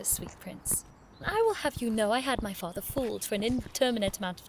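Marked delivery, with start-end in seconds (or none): 0.0-0.8 soft, breathy mocking aside; mocking murmur. Sharena muttering a mocking aside to Edric, breathy and soft. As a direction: mutter it under her breath.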